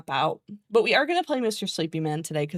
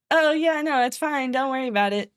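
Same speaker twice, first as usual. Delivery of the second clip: fake politeness; 6 semitones higher than usual.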